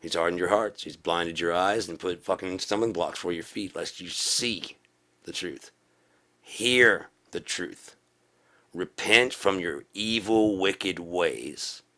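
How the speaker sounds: slow, emphatic pulpit rebuke; street-preacher call to repentance; fiery preaching. Acted. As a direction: preach it with stretched, punched key words.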